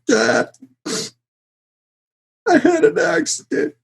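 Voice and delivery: high-pitched mock-crying phone call to dad; hammed-up tearful whine; tearful confession. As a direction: whimper it like a kid in tears. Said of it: "I was in tears".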